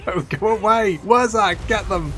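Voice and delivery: high, loud urgent orders; panicked commands. Real, not acted. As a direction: shout orders as the battle goes wrong.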